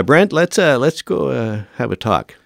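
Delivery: a hint of an awkward boss saying let's talk; mock stern official. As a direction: imitate the bosses calling him in, a touch ominous. Officials call him aside after meeting.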